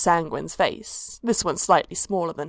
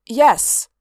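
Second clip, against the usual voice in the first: sudden louder yes; abrupt; 4 semitones higher, 6 dB louder than usual. A narrator's reading. First cultist blurts his oath, abrupt. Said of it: "said the first one abruptly".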